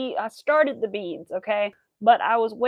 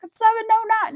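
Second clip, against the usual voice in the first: surprised; 11 semitones higher, 8 dB louder, faster than usual. Retold or imitated. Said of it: "it's like".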